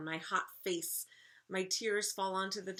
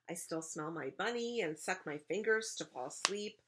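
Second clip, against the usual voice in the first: ordinary delivery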